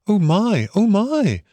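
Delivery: high, drawn-out swooping wonder; awed gasp. A narrator's reading. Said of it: "still gasping".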